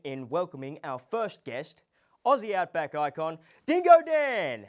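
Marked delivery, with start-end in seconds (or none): 2.2-4.7 loud, drawn-out build to the name; big show-host intro; announcer introduction. Acted. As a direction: announce the guest high and loud, stretching the name. Show host introduces the first guest.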